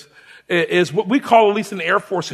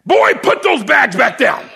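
slow, louder command; imitated drill shout; 4 semitones higher, 4 dB louder than usual. Sergeant ordering recruit, imitated shouted command. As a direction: bark the order down at him.

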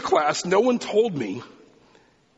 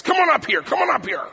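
voiced old preacher calling a student up, higher and louder; stern professor summons; 12 semitones higher, 3 dB louder than usual. Old professor calls the new student to the front, higher and louder. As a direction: beckon the shy student forward with authority.

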